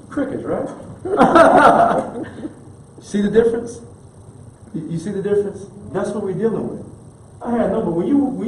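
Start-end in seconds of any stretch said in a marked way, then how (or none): 0.7-2.5 high, loud burst of laughter. Real, not acted. Preacher laughing at the silent friends.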